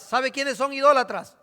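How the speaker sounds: normal talking voice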